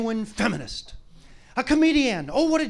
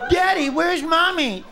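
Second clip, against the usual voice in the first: put-on higher, slow little kid's question; child's voice; 7 semitones higher, 3 dB louder, slower than usual. A small child asking the father in a high, slow voice. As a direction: ask like a small worried child.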